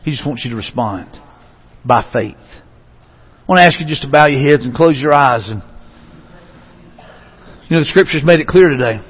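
low, hushed invitation to prayer; quiet, reverent close of a sermon; soft altar call. Real, not acted. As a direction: gently lead them to bow their heads, leaving long pauses. Leads closing altar call.

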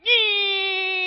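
high, whining engine noise; motor whine imitation. Preacher mimics the moped whining by. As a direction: mimic a little motor screaming past. Motor bike revving past.

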